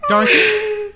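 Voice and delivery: high, loud worried call; a loud, high call of her name; concerned call. Acted. Scarecrow notices her crying.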